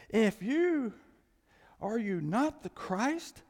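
high, loud, slow railing; railing criminal. Acted. Preacher voicing the criminal railing at Jesus, loud and high. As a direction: hurl it at him in desperate scorn.

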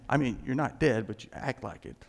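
ordinary talk

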